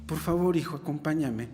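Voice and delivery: soft, gentle begging father; pleading father. Acted. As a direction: coax the son to come inside. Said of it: "le rogaba que entrase". (padre que ruega, suave y tierno)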